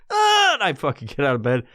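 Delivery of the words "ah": loud, high yelp; sudden high mock scream; startled comic scream. Man jolted out of bed, screaming.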